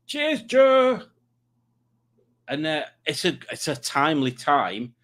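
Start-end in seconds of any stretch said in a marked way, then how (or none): 0.1-1.0 cheery shout. Real, not acted. Calling out thanks to a viewer.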